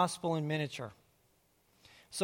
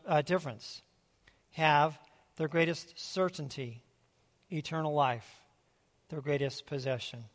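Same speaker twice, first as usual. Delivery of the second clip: solemn scripture reading. Acted. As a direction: lay each phrase down deliberately. Reads expanded verse slowly.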